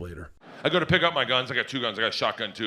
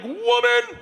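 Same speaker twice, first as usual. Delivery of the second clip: very high mock squawk; exasperated yelp; 4 dB louder than usual. Husband silencing his tattling wife, exasperated. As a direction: cut them off with a scandalized cry.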